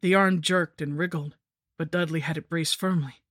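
usual speaking voice

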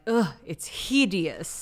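snobby dismissal. Retold or imitated. Snobby Chloe dismissing Eiffel Tower.

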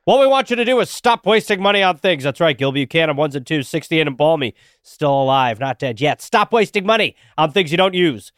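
upbeat pitchman read with pushed-up pitch. Acted. Host pitching the sponsor to listeners, ad-read voice. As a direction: sell it like a radio spot.

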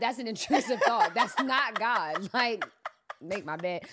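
amused disbelief, retold; laughing dismissal. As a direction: brush it off with a laugh. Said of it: "I literally was like".

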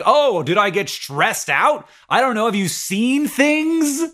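rising, high, slow sarcastic reply; sarcastic retort. Retold or imitated. Patient answering people blaming his flares, high sarcastic. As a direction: answer the blame with mock bewilderment. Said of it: "And it's like".

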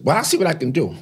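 loud, high grand imitation; hammed-up lofty concession, voice way up; Andre Leon Talley. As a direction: imitate a grand figure granting a favour. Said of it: "He said".

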